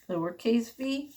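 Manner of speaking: usual speaking voice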